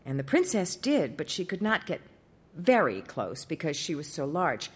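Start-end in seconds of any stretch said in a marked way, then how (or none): none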